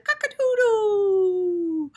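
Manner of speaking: high, slow, loud crowing; stretched-out rooster call. Acted. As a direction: crow it like a rooster at dawn. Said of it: "the cockadoo says".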